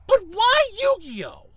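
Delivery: ordinary talk, nothing marked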